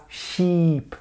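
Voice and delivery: "sheep" very drawn out with the tip of the mouth; wrong fronted vowel. Shown as a demonstration. Teacher mocks the French habit, "sheep" with an overlong front ee. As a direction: overdo a tense, fronted ee in "sheep". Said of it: "pas avec le bout de la bouche".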